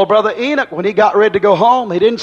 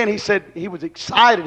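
ordinary talk, nothing marked

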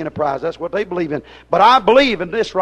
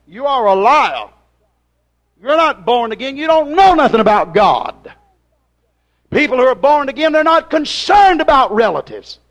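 accusing preaching; 3 semitones higher, 4 dB louder than usual. Acted. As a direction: call them out from the pulpit. Accusing the congregation from the pulpit.

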